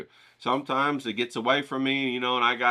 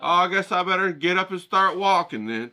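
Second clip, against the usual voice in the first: high, half-laughing sulky voice; mock sulky; 7 semitones higher than usual. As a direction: play the abandoned martyr, lightly. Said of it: "I'm like".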